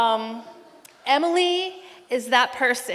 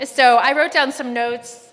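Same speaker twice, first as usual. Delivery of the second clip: usual speaking voice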